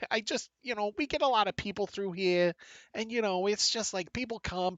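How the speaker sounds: very high-pitched, apologetic Boston clerk; over-explain yourself in a high Boston voice; Bostonian accent. Acted. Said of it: "a Bostonian accent".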